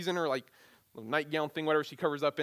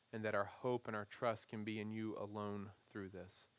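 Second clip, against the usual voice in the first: soft, low, measured prayer; lowered voice, earnest pastoral prayer; 4 semitones lower, 10 dB quieter than usual. Real, not acted. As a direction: pray aloud quietly and reverently.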